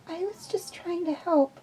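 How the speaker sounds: put-on sniffly little girl; tearful child's voice; small girl voice, sad. Omega sniffling, turned away.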